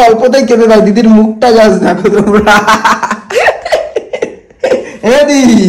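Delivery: giggling through the words; amused teasing. Real, not acted. Prankster shows viewers the tearful sister's face, amused and laughing. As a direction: tease her, breaking into real laughter.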